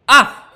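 loud high startled shriek; startled scream. Real, not acted. Gamer shocked by a sudden scare, loud shriek.

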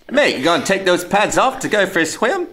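put-on high-pitched parody of an Australian accent; mock Australian accent. Podcaster imitating co-host's beach line, mock Australian accent. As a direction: send up his Aussie accent, amused. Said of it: "Incredible accent".

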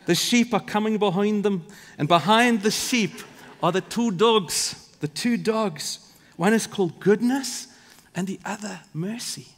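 hammed-up Scottish shepherd accent; bad Scottish accent. Preacher voicing a shepherd describing his two dogs, terrible Scottish accent. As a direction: have a go at a Scottish accent, badly. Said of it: "That's a horrible Scottish accent".

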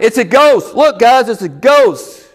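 mock-frightened cry to the others; fearful cry, acted. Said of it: "they cried out".

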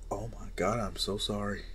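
whispered, breathless apology; remorseful whisper. Acted. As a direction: whisper it, ashamed and small. Izuku apologizing in chains.

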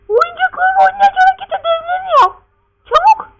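shrieked falsetto order; very high, loud mock-mother yell; scolding mother. Mother ordering her son to do homework, screechy scolding.

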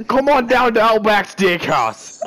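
high, hammed-up Australian ad voice; Australian accent. Friend retrying the steakhouse advert, in high mock Australian accent. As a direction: push out a big mock Australian invitation. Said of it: "that was pretty good".

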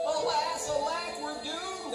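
high, melodramatic lament; hammed-up storybook despair; mock wailing. A narrator's reading. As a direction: wail like doomed beavers. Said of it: "they wailed".

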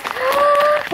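sharp excited intake of breath; high thrilled gasp; delighted gasp. Real, not acted. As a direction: gasp with joy. Seeing the extras.

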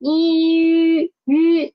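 long "E" sliding into "Yu" with rounded lips. Shown as a demonstration. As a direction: hold "E", then round the lips into "Yu", tongue still. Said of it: "say Yu again from E".

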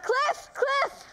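high, frantic cry of a name; panicky, high-pitched calling; distraught calling. Acted. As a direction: call out as if waking from a nightmare. Wakes from dream calling dead man.

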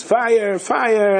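cry of fire. Retold or imitated. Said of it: "there's the screams".

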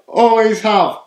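ordinary delivery

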